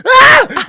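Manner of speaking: loud, very high yelp of shock; shocked scream. Retold or imitated. Walking in on a roommate, screaming in shock. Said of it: "and I'm like".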